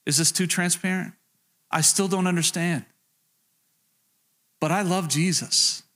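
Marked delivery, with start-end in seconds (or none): none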